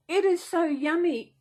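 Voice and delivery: gushing delight. Real, not acted. Feeling the fleecy blanket, thrilled and gushing.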